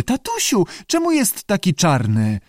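very high child-mimicking creature; contented lizard mimicry. A narrator's reading. Lizard parrots a child asking dad, high-voiced. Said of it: "chrząkał zadowolony".

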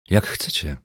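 cool, indifferent shrug. A narrator's reading. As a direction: toss it off, uninterested. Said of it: "odpowiedział obojętnie".

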